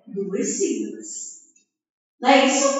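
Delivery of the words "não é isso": usual speaking voice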